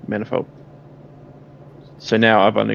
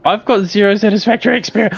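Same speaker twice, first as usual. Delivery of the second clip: squeaky joke voice; 10 semitones higher, 7 dB louder than usual. Pretend. High-pitched joking complaint.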